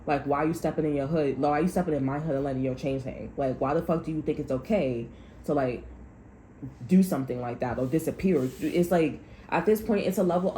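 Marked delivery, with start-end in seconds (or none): none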